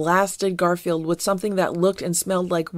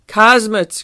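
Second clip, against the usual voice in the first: loud, high cry of warning; alarmed cry; 4 semitones higher, 8 dB louder than usual. Acted. Fieldgar dives behind a tree, alarmed cry. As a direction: cry out at sudden danger. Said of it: "cried Fieldgar".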